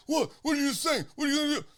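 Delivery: high-pitched imitated challenge. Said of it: "He's like".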